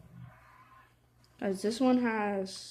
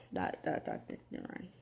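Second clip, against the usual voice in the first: whispered; 12 dB quieter than usual. Real, not acted. Gives up on the thought.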